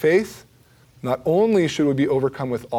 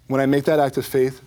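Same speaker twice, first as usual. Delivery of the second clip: ordinary delivery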